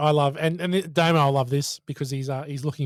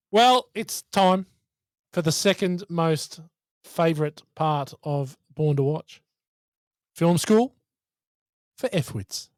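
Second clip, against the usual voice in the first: slow, drawn-out host introduction; mock-grand podcast segment intro; segment announcement; 2 semitones higher, slower than usual. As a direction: announce it in stretched, spaced-out phrases.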